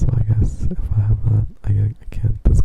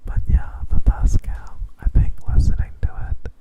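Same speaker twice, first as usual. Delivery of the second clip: ASMR whisper; 4 semitones higher, slower than usual. Real, not acted.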